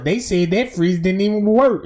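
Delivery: normal talking voice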